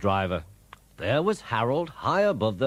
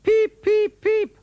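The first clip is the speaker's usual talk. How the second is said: very high, loud engine whistle; whistling peeps; 10 dB louder, slower than usual. A narrator's reading. Percy signals the guard, piercing high whistle. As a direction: pipe it like a little train whistle. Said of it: "whistled Percy".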